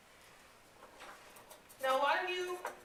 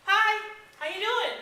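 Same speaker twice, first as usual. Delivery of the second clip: higher and louder, eager greeting; bright eager greeting; 10 semitones higher, 14 dB louder than usual. Shown as a demonstration. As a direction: greet them with real enthusiasm. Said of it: "Is there a difference in my tone?".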